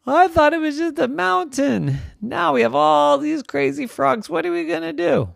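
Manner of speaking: put-on high, fretful girl's voice; a scared kid asking for help; Wendy frets to her neighbor, high and worried; worried little Wendy. Wendy panics about frogs.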